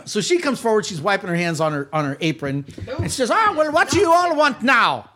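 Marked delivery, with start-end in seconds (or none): 3.3-5.1 raised, higher, put-upon voice; gruff welcome to unwanted visitors, loud and high; irritated matron. Acted. Orphanage keeper greeting intruders, irritated.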